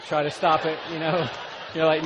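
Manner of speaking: loud, driving preacher's storytelling; preaching at full force. Acted. Preacher to congregation, loud full-force delivery. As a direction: preach it big to the crowd.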